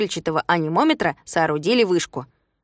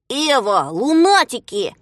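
drawn-out, louder cry of alert; возглас Тюбика; 3 semitones higher, 4 dB louder, slower than usual. A narrator's reading. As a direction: call out on sighting someone, stretching the word. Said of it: "закричал Тюбик". (протяжный громкий возглас)

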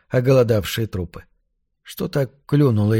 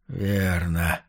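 low, soft, hoarse reply; rasped answer, dropped low and quiet; hoarse croak; 6 semitones lower, 7 dB quieter than usual. A narrator's reading. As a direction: croak it out weakly. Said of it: "Прохрипел он".

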